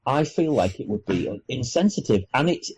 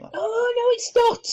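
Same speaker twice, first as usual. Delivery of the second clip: mock outrage. Reacting to braids as appropriation.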